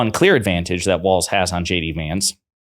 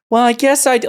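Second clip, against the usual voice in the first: mock child reply; 5 dB louder than usual. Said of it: "like a child".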